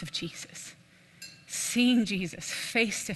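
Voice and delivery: hushed reverent whisper. Real, not acted. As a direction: breathe the name in awe. Tender remark about papa in heaven.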